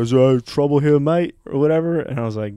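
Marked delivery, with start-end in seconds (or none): 0.0-1.4 a hint of challenging Australian mate; lightly voiced Australian accent. Australian drinking buddies back him up, light Australian accent. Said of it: "all the Australian guys were like".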